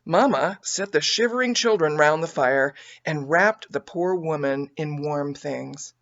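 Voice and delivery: usual speaking voice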